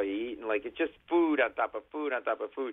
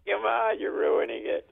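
put-on higher, louder whine of a kid told to stop; whiny kid complaint; 10 semitones higher, 3 dB louder than usual. Kid whining at a bleeding friend, high and loud. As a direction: whine like a kid whose sled run is ruined.

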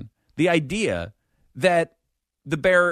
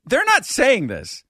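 ordinary delivery

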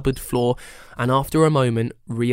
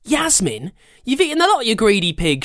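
growled; 9 semitones higher than usual. Acted. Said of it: "she growled up the stairs".

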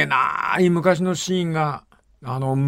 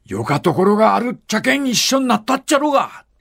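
a lightly voiced old father scolding in broad Kyushu dialect, pitched higher; 7 semitones higher than usual. Retelling a film, the father rebukes his daughter in Kyushu dialect. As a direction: imitate a Kyushu father's gruff reproach, falling at the end. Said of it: "わかるか、九州弁".

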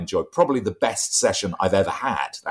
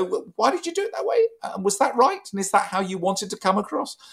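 quick, much higher, probing questions; questioning voice coach; 11 semitones higher than usual. Retold or imitated. Voice trainer quizzing a presenter on their take, quick and high. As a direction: play the outside ear querying each choice. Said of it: "listening across to what you're doing and go".

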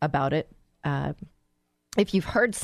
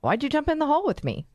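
high, quick baffled question; acted-out bewilderment, pitched up and rushed; confused; 9 semitones higher, faster than usual. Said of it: "you're just plain confused".

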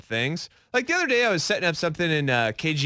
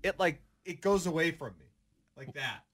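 far from mic; 8 dB quieter than usual. Real, not acted. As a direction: voice drifting away from mic. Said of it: "every single time I let go of it".

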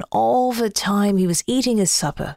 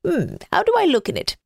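quick, higher snowman; 7 semitones higher, faster than usual. Acted. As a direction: ask eagerly as the snowman. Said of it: "he asked".

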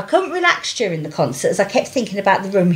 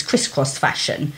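normal talking voice

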